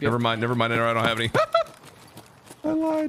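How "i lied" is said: laughing confession. Real, not acted. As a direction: laugh it out high and light. Laughing at own mistake.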